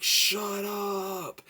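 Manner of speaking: high, yelled shut up, imitating the cop; mock yell at kids. Vlogger imitating the cop yelling at the kids, mock shout. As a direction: shout it like the cop losing his patience.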